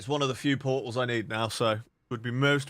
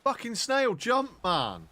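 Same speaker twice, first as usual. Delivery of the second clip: exasperated yell; 10 semitones higher, slower than usual. Real, not acted. Frustrated at mount in game.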